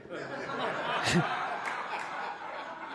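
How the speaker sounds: a soft, airy laugh at the memory; breathy laugh. Real, not acted. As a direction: let a quiet laugh escape.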